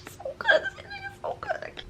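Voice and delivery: tiny, very high weepy squeak; soft tearful squeal, voice giving way; overwhelmed. Real, not acted. Reader overcome by a book's ending, tearful.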